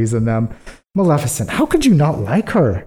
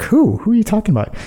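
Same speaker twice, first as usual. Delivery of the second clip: higher, puzzled queen asking who; mock confused queen; 8 semitones higher, faster than usual. Queen asking the king who has arrived, higher and puzzled. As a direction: play the queen baffled by the news. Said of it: "And the queen's like".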